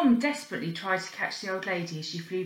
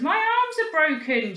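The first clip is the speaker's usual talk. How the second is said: higher, louder reply; calling back; 8 semitones higher, 6 dB louder than usual. Acted. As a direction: call it back across the hall. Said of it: "she called back".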